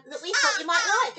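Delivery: ordinary delivery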